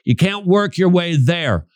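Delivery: usual speaking voice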